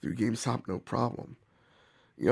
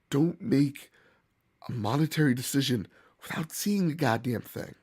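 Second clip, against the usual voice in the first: normal talking voice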